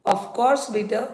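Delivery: ordinary delivery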